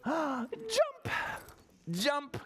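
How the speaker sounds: high, leaping exclamation; spring up on the word with the children; excited jumping. Shown as a demonstration. Leads children jumping like frogs.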